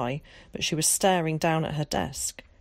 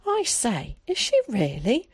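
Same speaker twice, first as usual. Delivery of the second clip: mock surprise; 7 semitones higher, 3 dB louder than usual. A narrator's reading. Zack mocking reply.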